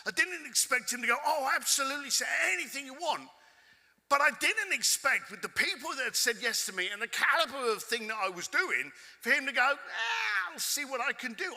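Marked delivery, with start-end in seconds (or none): 1.2-3.3 mock eager yes. Imagined eager reply, high. 9.8-11.5 mock dismissive reply. Mocking the curator's brush-off.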